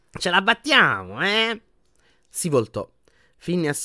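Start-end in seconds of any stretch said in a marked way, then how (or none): 0.1-1.6 high, slow, drawn-out sneer; scornful. A narrator's reading. Portrait sneering at Harry as he sneaks off, scornful. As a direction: taunt with lofty contempt, lifting the pitch. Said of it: "una voce sprezzante disse".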